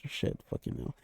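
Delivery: whispered curse under the breath; hissed swear after a slip-up; muttered curse. Real, not acted. Muttered curse after a flubbed line.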